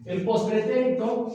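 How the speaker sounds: usual speaking voice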